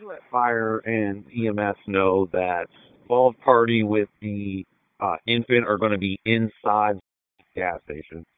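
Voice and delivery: officer's voice over a radio channel; transmitted through a handheld police radio. Real, not acted.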